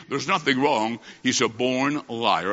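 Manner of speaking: normal talking voice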